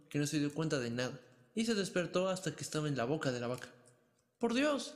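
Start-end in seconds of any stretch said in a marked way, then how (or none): 4.4-4.9 sudden high cry of surprise; startled exclamation. A narrator's reading. Pulgarcito wakes up in the cow's mouth, startled high cry. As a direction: gasp it out in shock. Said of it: "exclamó".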